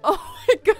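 high, loud laughing exclamation; real helpless laughter; laughing hard. Real, not acted. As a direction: burst out laughing as you speak. Cracking up at game glitch.

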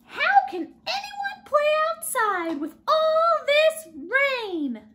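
high, loud whiny piggy voice; frustrated Piggy. Acted. Piggy complaining to Gerald about the rain. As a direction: moan about the rain, fed up.

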